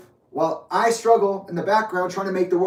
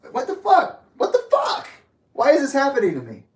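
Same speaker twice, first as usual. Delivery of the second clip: much higher squeaky mock voice; mock bewilderment; 7 semitones higher than usual. Mocking relatives acting shocked at his situation. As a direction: shriek it like a fake-shocked relative.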